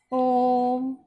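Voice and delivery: ओम with the ओ held long on a level tone; extra-long vowel. Shown as a demonstration. Teacher giving an example of a prolated vowel, ओम drawn out. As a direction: stretch the ओ of ओम before closing on the m. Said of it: "से भी अधिक समय लगता है".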